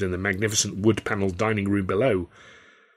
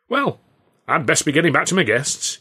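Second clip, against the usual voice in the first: put-on much higher, bluff old governor; a host hurrying back; light hearty governor voice; jovial Carno; 7 semitones higher, 4 dB louder than usual. A narrator's reading.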